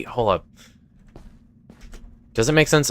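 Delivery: ordinary talk, nothing marked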